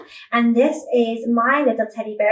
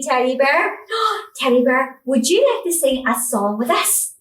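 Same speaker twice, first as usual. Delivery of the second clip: ordinary delivery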